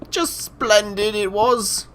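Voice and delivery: high, slow put-on British accent, badly done; a hammed-up British accent, slightly strained. Gushing about Doctor Who to viewers in a bad British accent. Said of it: "That was a really bad British accent".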